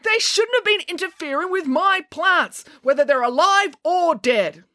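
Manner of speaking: high, strained angry rant; worked-up, high-pitched complaint; outraged protest. A narrator's reading. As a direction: keep up the indignant yelling. Groundskeeper continues his yelled protest.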